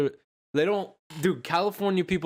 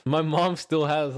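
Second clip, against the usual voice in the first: ordinary delivery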